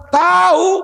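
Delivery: sudden high shout; loud outburst. Real, not acted. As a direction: hit it hard to wake them. Preacher scolds lazy sleepers. (teriakan keras)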